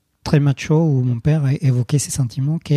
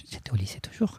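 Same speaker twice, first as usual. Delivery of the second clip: whispered aside; 4 semitones lower, 13 dB quieter than usual. Real, not acted. Aside correcting his own story.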